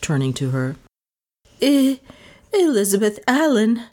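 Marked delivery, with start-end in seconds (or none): 1.6-3.8 high, nervous stammer on the name. A narrator's reading. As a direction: trip over the first sound, frightened. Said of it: "stammered Lizzie".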